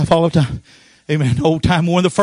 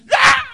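high shout; ecstatic cry. Retold or imitated. Vernie shouting again.

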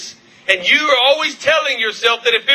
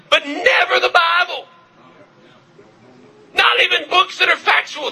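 high, shouted rebuke; rising preacher's shout; preaching shout; 7 semitones higher than usual. Real, not acted. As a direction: cry it out at full force.

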